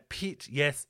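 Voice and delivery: ordinary delivery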